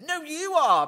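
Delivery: high, loud schoolyard comeback; childish playground retort. Retold or imitated. Preacher mocking a playground comeback, childish taunt. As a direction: fire it back like a kid with no better answer. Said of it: "like being on a playground".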